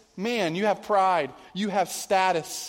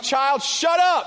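louder, higher, slow mock snap at the kid; 6 semitones higher, 5 dB louder, slower than usual. Pastor jokingly snapping at his begging child, louder and slower. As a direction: play-act losing patience, half joking.

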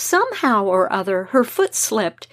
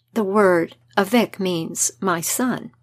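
ordinary delivery, nothing marked

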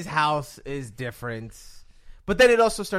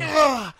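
mock grunt; 10 semitones higher, 7 dB louder than usual. Acting out the climax.

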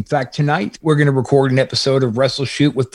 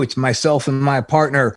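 normal talking voice

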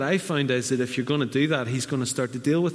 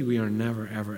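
ordinary talk, nothing marked

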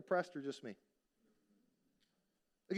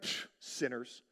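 lower, softer, slow dismissive scoff; smug scoff; 8 semitones lower, 6 dB quieter, slower than usual. Retold or imitated. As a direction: sneer at other people's sin. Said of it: "And you're like".

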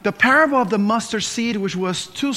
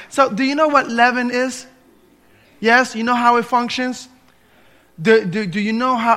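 ordinary talk, nothing marked

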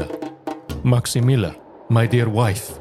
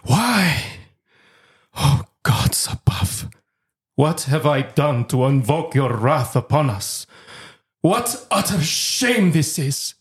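raised, despairing cry to the gods; grief-stricken, high-pitched outburst; anguished lament; 4 semitones higher than usual. Acted. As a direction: rail at heaven in shame.

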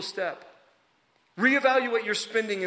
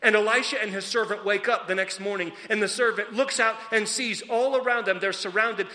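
ordinary delivery

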